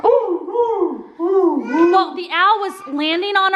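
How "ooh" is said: imitated owl hooting, higher than speech. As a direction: hoot like an owl down a chimney. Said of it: "an owl shooting his voice down our fireplace going".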